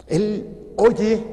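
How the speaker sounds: a loud, stirring preacher's call; emphatic proclamation. Real, not acted. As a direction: announce it to the crowd.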